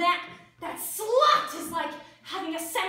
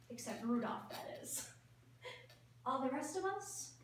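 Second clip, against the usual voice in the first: ordinary delivery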